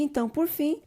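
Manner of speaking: ordinary talk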